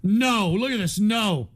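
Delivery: normal talking voice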